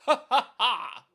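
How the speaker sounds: loud, high mock villain cackle; mock villain laugh. Streamer playing at Professor Chaos, mock villain laugh. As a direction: punch out each ha like a cartoon villain.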